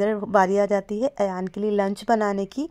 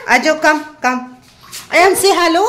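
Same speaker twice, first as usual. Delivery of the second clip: yelling across the room; 8 semitones higher, 9 dB louder than usual. Real, not acted. Calling toddler over to camera.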